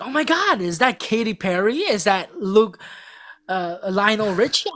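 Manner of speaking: overdone star-struck squeal, clearly put on; fake excitement. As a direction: gush on cue like a starstruck fan. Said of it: "And it was so fake".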